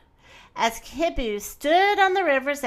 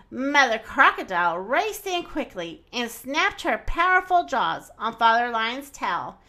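ordinary delivery

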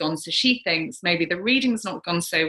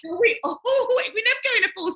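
laughing; 13 semitones higher than usual. Real, not acted. Laughs mid-line.

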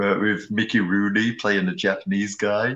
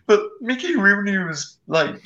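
usual speaking voice

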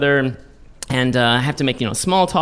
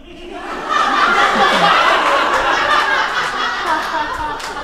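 laughing. Real, not acted. Audience reacts to her own gaffe.

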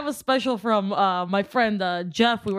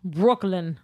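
put-on Brooklyn accent; 3 semitones lower, much slower than usual. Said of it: "Is that your Brooklyn accent?".

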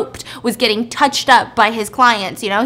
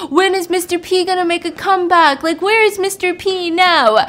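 high, raised mock fan voice; clamoring fan; 7 semitones higher, 4 dB louder than usual. Fans commenting on a retired actor's clips, clamoring. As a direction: demand it like an eager commenter.

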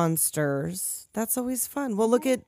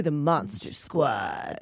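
lower, slow, heroic reveal; dramatic line; 9 semitones lower, 4 dB quieter, much slower than usual. Retold or imitated. Kids announcing themselves to the army, slow heroic. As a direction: drop low and slow like a movie hero.

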